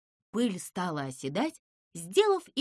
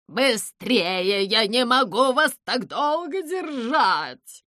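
loud, stretched-out urgent plea; laboured, loud urging; straining effort; 7 dB louder, slower than usual. Acted. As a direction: call out as if the load is slipping. Owl struggles to hold them.

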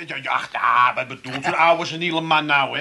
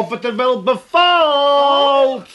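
slow sing-song; 9 semitones higher, 8 dB louder, much slower than usual. Acted. Showing the room, slow and odd.

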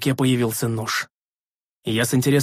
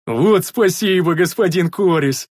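slow, higher, loud delight; delighted roar; 5 semitones higher, 4 dB louder, slower than usual. A narrator's reading. Big man thanking his master, delighted roar. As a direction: roar thanks like a happy bear. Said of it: "Обрадованным медведем заревел Рикар".

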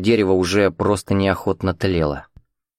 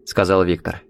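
ordinary delivery

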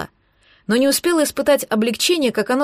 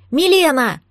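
higher-pitched urgent call; yelling; 4 semitones higher, 3 dB louder than usual. A narrator's reading. Girl spots a light below, yelling the name. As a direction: call out for someone far below. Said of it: "заорала я".

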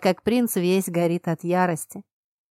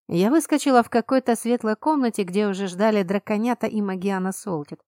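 ordinary delivery

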